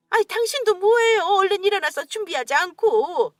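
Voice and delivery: wife urging her husband to get ready, excitedly; excited nagging. Acted. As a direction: hurry him along, giddy. Mother hurries husband excitedly.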